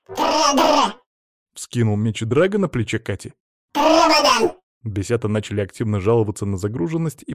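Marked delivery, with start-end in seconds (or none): 0.1-0.9 very high, loud creature squeal; piercing pet-monster chirrup; little dragon cry. Acted. Small dragon creature cries out. 3.7-4.5 very high, loud creature squeal; piercing pet-monster chirrup; little dragon cry. Acted. Small dragon creature cries out.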